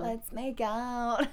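very slow, soft seductive line; sexy voice. Retold or imitated. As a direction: draw it out slowly like a come-on. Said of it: "that's a super sexy voice".

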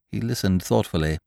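ordinary delivery

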